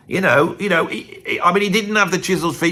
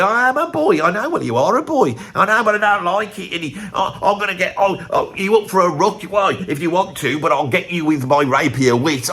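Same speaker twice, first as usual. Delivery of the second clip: a higher, sulky imitation of a rock singer; 5 semitones higher than usual. Singer grumbling at his songwriter, hammed-up and higher. Retold [name]-[name] argument.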